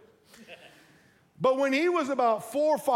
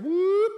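high, loud "whoop" swoop; imitated whoosh of grabbing a face; sudden rising whoop to grab attention; mock grab noise; 10 semitones higher, 6 dB louder than usual. Acts out grabbing your face.

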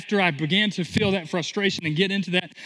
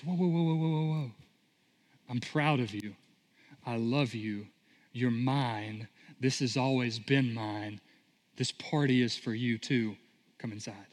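much softer and lower, calming and gentle; tender father; 6 semitones lower, 7 dB quieter than usual. Retold or imitated. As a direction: soothe the angry son, quiet and loving. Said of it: "he looks at him and goes".

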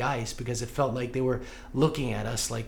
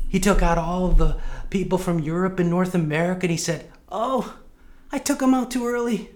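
imitating Mom's thick Filipino accent, God's lines pitched higher; 4 semitones higher, 5 dB louder than usual. Son retelling mom's comforting joke in Filipino accent. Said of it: "She would say in her thick Filipino accent".

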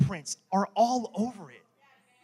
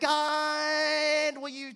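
high, impatient nudge at God; mock demand to God; 9 semitones higher, much slower than usual. Pastor mocking his own prayer, high and impatient. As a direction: pester God like a buddy. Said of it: "I don't talk to him like that".

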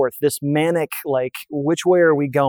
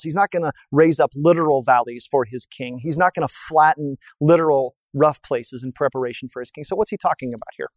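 normal talking voice

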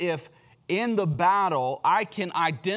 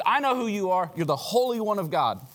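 higher, raised-voice outcry; demon screaming out; 6 semitones higher, 3 dB louder than usual. Acted. Demon confronts Jesus, crying out. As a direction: call it out, a restrained scream. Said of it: "screams out".

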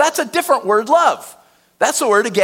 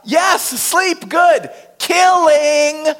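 loud, high cry of the word; preacher shouting the ignored point; exasperated shout; 8 semitones higher, 4 dB louder, much slower than usual. Real, not acted. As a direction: shout it out in disbelief. Stressing ignored danger.

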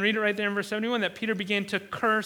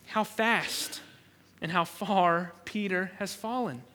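ordinary delivery

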